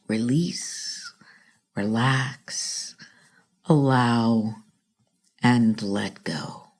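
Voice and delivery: low, soft and slow, one word at a time; soft slow release. Shown as a demonstration. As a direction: let each word sink down softly. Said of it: "say it soft".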